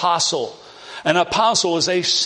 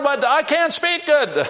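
whiny Moses; 9 semitones higher than usual. Acted. Moses protests to the Lord.

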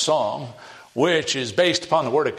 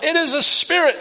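high, loud acted cry of alarm; fearful cry; 7 dB louder than usual. Disciples crying out at what seems a spirit, fearful cry. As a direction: cry out as if spooked. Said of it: "they cried out".

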